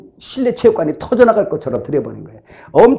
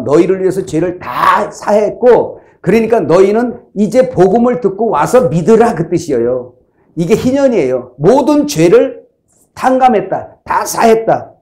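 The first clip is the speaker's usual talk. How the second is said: higher, louder proclamation with slowed key words; the preacher rising to declare all sins forgiven; preaching at full force; 3 semitones higher, 4 dB louder than usual. Acted. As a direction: proclaim the forgiveness like a trumpet blast. Preaching climax on the jubilee.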